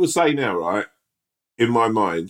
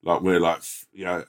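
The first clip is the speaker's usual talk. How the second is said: ordinary talk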